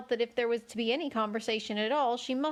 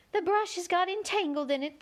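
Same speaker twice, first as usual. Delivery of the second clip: sighing; 8 semitones higher than usual. A narrator's reading. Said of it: "with a sigh".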